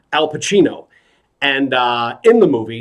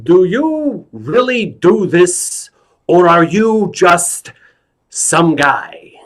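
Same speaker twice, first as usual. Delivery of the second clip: slow, high, drawn-out challenge; Al Pacino; 4 semitones higher, slower than usual. Acted. Film star questions whether clown is real, slow hammed-up impression. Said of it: "Now, Al, you know, turns to me".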